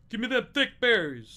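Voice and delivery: loud, high hammed-up rap line; hammed-up shout. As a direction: belt it like a joking rap. Parody of [name].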